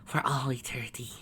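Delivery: whispered promise, softly trailing; soft, airy, seductive murmur; sweet whisper. A narrator's reading. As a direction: whisper it tenderly into an ear. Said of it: "she whispered in her ear".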